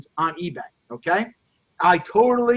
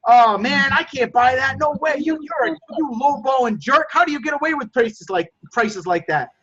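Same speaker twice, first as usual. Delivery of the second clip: mock angry customer; 4 semitones higher than usual. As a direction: rant at the lowballer in jest. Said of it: "whatever they say".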